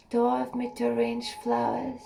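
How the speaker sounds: ordinary delivery, nothing marked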